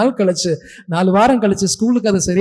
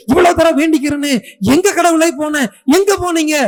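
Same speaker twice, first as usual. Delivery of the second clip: troubles piled up in a rising, higher voice; exasperated lament; 6 semitones higher than usual. Acted. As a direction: build from usual pitch to a high, desperate complaint. Acted believer's complaint to God. (படிப்படியாக உயரும் புலம்பல்)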